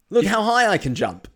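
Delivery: high, loud, eager boast; excited shout. Pretend. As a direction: show off with a big loud voice. Boris Johnson voiced, loud high.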